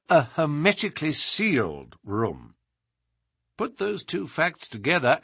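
measured, emphatic sleuth laying out a case; animated detective monologue, above the narrator's pitch; explaining detective. Acted. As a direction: voice Holmes expounding with assurance. Holmes expounds his deductions.

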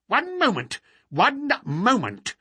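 high-pitched querulous cry; fussy, complaining old man's interjection. A narrator's reading. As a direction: call out peevishly to interrupt. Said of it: "cried a querulous voice".

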